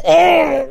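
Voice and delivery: very high cry of pain; hammed-up crying groan. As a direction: wail from the blow. Customer in pain.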